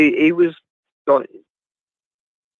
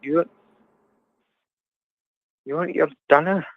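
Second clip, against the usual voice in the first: put-on Scottish accent; hammed-up Scottish host. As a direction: offer it in playful Scots. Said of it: "talk with my Scottish accent".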